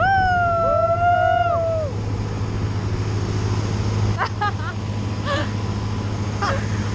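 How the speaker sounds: excited shrieking laugh. Real, not acted. Thrill ride excited scream-laugh.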